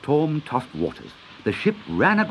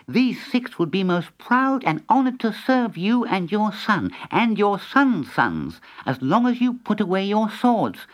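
higher, formal cat character; talking cat, courteous; 7 semitones higher than usual. Acted. Tom the cat addressing the Sultan, courteous. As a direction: address a ruler as a dignified cat.

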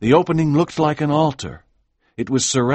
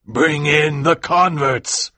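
louder, slowish summoning cry; ritual call; 4 semitones higher, 4 dB louder, slower than usual. Acted. Voice at the altar summoning the converts, a loud call. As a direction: announce it to the congregation. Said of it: "a voice cried".

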